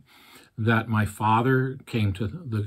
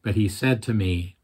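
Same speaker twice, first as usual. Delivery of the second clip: ordinary talk, nothing marked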